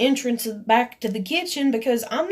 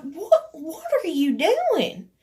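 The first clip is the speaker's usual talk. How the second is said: slow, higher-pitched disbelief; incredulous demand; 6 semitones higher, slower than usual. Retold or imitated. Retelling the row over the cigarette, slow and incredulous. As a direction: re-enact a drawn-out, indignant challenge. Retelling their challenge in the argument.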